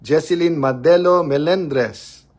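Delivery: high, loud and slow announcing of a viewer; slow loud name call. Real, not acted. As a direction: announce the viewer like calling them up. (mabagal at malakas na pagtawag sa pangalan)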